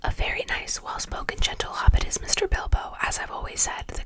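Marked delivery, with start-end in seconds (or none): none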